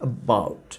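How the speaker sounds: "about" with a tiny, swallowed uh before baut; schwa in about. Shown as a demonstration. Teacher demonstrates the weak first syllable, low and soft. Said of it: "দেখেন".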